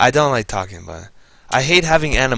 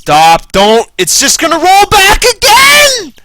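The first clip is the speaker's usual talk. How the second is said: much higher, loud frustrated yell; real shout; 10 dB louder, slower than usual. Real, not acted. Player yelling at the game rolling back, frustrated shout. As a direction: scream at the game to stop.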